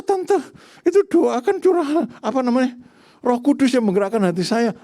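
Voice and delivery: high-pitched mimicked grumbling; acted complaint. Preacher retells a sibling complaining about long prayers at church, mock protest.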